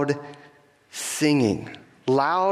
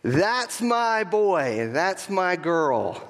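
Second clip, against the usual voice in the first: higher, very slow, drawn-out proud call; proud parent calling out; 4 semitones higher, much slower than usual. Retold or imitated. Mother in the stands proclaiming her son for all, slow and proud. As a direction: stretch each word like a proud mom in the stands. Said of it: "for the whole world to hear".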